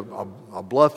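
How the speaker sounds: usual speaking voice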